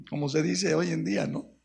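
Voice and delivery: ordinary talk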